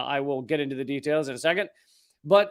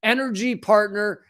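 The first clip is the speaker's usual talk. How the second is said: bossy US government voice; 5 semitones higher, 3 dB louder than usual. Retold or imitated. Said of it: "The United States said".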